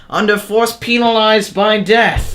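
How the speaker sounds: put-on slow, high-pitched British accent; mock British accent. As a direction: stretch it out as a caricature Brit. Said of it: "Did we do accents".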